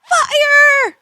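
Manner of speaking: loud high alarmed shout; shouting fire. Acted. Copilot spots fire ahead, loud alarmed shout. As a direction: yell the alarm.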